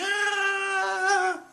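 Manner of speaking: very high squeal of delight; a crowd's thrilled squeal; crowd cheering. Retold or imitated. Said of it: "everybody's like".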